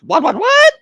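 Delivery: loud, very high squawk; mock shock. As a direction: shriek it in comic disbelief. Acted shock at lewd remark.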